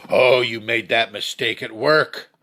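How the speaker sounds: rising to a high, loud accusing yell, slowing on "your fault"; yelling blame. Acted. Coworker blaming you at work, building to a loud yell. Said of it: "people getting into your space at work".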